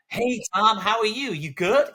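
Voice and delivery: very high, loud, over-friendly boss; exaggerated fake-cheery head teacher; schmaltzy greeting. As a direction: gush it in a hammed-up high voice. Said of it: "really schmaltzy".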